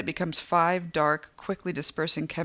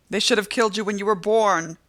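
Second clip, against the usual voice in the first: high, louder scream, hitting killed; 5 semitones higher, 8 dB louder than usual. A narrator's reading. Woman screaming through the glass door. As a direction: spit it with hatred. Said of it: "the woman screams".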